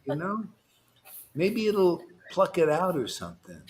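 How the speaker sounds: ordinary talk, nothing marked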